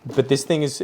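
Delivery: normal talking voice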